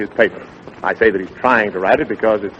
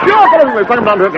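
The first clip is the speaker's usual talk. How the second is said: much higher and louder call; shouted character line; 11 semitones higher, 5 dB louder than usual. Acted. Dick shouts a character's call in the lion story. Story voices in garbled tale.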